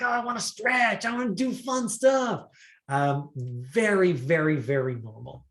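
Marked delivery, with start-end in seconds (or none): 0.0-2.5 eager artist. Retold or imitated. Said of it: "you're an artist and you're like".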